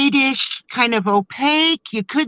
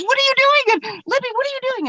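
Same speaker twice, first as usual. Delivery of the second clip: very high-pitched imitation of alarm; Maureen freaking out; much faster than usual. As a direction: squeal it like a panicking friend. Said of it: "She's freaking out at this moment".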